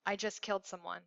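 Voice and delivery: low, flat and quiet, barely any tune; quiet flat male voice. A narrator's reading. Caller confessing to Andy on the phone, flat and hushed. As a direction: confess it in a hushed, even monotone. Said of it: "low, flat".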